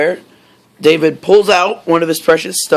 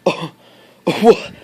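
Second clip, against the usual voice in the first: surprised gasp; 3 semitones higher than usual. Retold or imitated. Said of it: "They're like".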